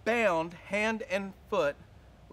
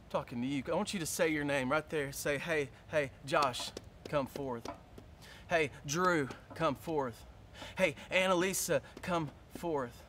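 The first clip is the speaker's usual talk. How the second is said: soft calling; 6 semitones lower, 6 dB quieter than usual. Acted. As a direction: gently call each one forth. Soft low slow calling.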